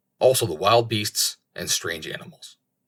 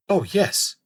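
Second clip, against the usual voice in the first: eager; 10 semitones higher than usual. A narrator's reading. Raven answers eagerly.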